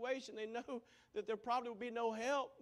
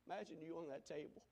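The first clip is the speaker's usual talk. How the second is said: ordinary talk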